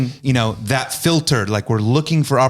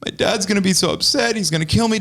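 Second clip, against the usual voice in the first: higher-pitched imitation of an upset girl; mock-distressed, high-voiced retelling; retold tearful fretting; 8 semitones higher, faster than usual. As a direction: mimic her worried wailing, without real sobs. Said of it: "she's crying, and she's going".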